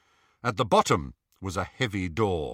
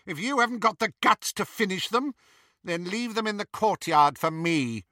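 high-pitched contempt; scornful command; 10 semitones higher than usual. A narrator's reading. Bellatrix scorning Draco's lack of guts, high-pitched contempt. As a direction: sneer the order up high.

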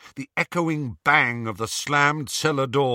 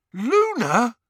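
high, astonished question; surprised; 13 semitones higher, 7 dB louder than usual. A narrator's reading. Harry finding Luna in the cellar, surprised. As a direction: question it in sudden recognition. Recognising a friend in darkness.

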